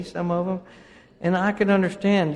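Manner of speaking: usual speaking voice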